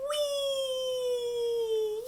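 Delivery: high gleeful wee; a soaring wee as if on a swing; a swing-ride squeal for the kids. Pretend. Pretend swing.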